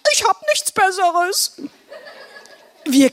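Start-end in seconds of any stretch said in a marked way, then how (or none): 0.1-1.6 high, silly character voice; shrugging Blodi. Acted. Blodi excuses his wooden spoon, shrugging. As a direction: offer a helpless excuse in a raised pitch. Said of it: "zuckte mit den Schultern und sagte".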